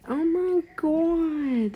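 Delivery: slow, high dismayed exclaim. Real, not acted. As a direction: stretch it out in dismay. Dread at exam date.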